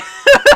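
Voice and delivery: loud high cackling laugh; burst of laughter. Real, not acted. Game master amused by gibberish words, real high laugh. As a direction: crack up suddenly and loudly. Table cracks up at gibberish exchange.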